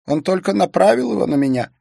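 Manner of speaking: high, tense voice of a shocked man; shaken man voice. A narrator's reading.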